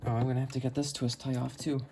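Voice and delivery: ordinary talk, nothing marked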